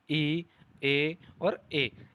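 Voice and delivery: "ई", "ए" each drawn out very slowly and clearly; careful, slow demo of the front vowels. Shown as a demonstration. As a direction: sound out "ई, ए और ए" one by one, slowly. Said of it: "अग्र स्वर में जीवा के आधार पर".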